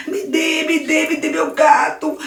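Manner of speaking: Daniel fighting Luiz for his cat, high voice; desperate child demand. Acted. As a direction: grab for the cat.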